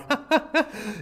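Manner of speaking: sudden high burst of laughter. Real, not acted. Streamer amused by a player who doesn't score, high laugh. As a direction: crack up in a high-pitched giggle.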